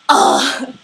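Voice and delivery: loud, rough groan; exasperated ugh. Real, not acted. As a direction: groan it out, overwhelmed by fandom. Overwhelmed by her shipping obsession.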